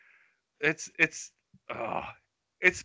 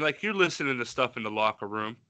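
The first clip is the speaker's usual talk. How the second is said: ordinary talk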